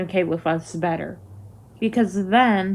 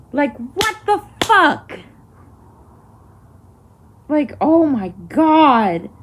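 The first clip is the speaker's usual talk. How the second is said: exasperated; 4 semitones higher, slower than usual. Real, not acted. Ranting about medical neglect.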